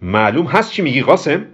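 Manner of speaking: high, loud rebuke; angry. Acted. Kobra rebukes Ghasem for his remark, angry. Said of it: "با عصبانیت گفت".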